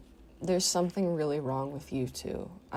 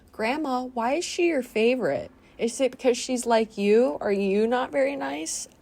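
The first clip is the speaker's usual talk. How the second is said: higher, a touch of mock-innocent sass; sassy to grandma; 6 semitones higher than usual. Asking grandma, lightly higher sarcastic questions. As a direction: needle her with fake innocence.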